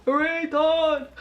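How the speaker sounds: slow, loud, laughing cartoon cheer, Sloth-like; Todd impression. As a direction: whoop it like a goofy cartoon guy. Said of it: "I make him sound like Sloth".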